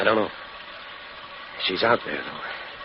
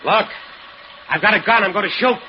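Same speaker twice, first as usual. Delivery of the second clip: much higher and louder; threatening shout; 11 semitones higher, 4 dB louder than usual. Acted. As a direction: bluff hard. Husband bluffs with pipe.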